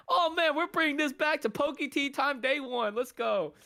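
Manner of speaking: high-pitched, hammed-up excitement; mock hype. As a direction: play yourself getting carried away with hype. Acts out his hyped future self.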